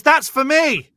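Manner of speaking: high, loud, eager grab; snatch the message for yourself, loud and high; eager claim. Retold or imitated. A stranger at the show loudly claiming the medium's message. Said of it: "the bloody guy next to me goes".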